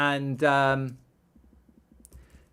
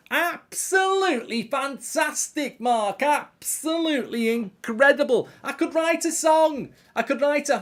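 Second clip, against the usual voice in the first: much higher put-on voice, gushing praise; gushing singer voice; 12 semitones higher, 5 dB louder than usual. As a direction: lay on the gushing like a pop star. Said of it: "another impression for you".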